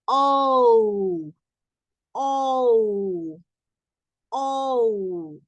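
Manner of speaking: oh with lips rounded into an O. Shown as a demonstration. Teacher drilling the oh sound with the class. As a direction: hold a round French oh. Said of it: "Intenten ustedes hacerlo".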